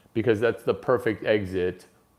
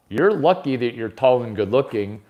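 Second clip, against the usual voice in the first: ordinary delivery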